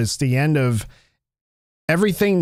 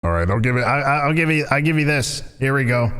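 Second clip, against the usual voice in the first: ordinary talk, nothing marked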